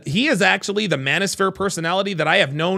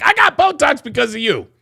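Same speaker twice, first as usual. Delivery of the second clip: higher-pitched retort imitating the woman; mock accusing woman; 8 semitones higher, 4 dB louder than usual. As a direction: act out her indignant greeting. Acting out the woman's confrontation.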